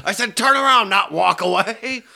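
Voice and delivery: high, irate shouted command; retold exasperated yell; yelled order. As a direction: imitate a sergeant barking after someone leaving. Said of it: "and he just yells".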